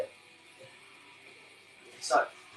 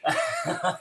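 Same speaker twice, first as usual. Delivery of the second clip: short, higher-pitched chuckle; laugh; 4 semitones higher than usual. Real, not acted. [name] lets Kelly off for teasing, chuckling. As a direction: chuckle before forgiving the teaser.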